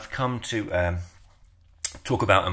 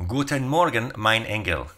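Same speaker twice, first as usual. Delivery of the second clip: higher, hammed-up German accent; exaggerated German accent; 4 semitones higher than usual. Shown as a demonstration. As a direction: overdo the German accent, pitched up. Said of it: "That's even worse".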